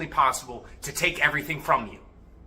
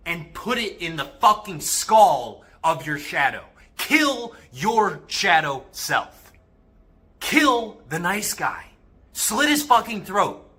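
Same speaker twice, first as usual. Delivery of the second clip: loud, raised-pitch, emphatic urging; forceful exhortation; 3 semitones higher, 5 dB louder, slower than usual. Real, not acted. Urging guys to kill their shadow self, fierce and forceful. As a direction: drive each command home hard and loud.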